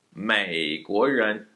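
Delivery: 美国人 said very slowly, tones clear; built from the back. Shown as a demonstration. Teacher builds the phrase up for students, very slow. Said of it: "So start there".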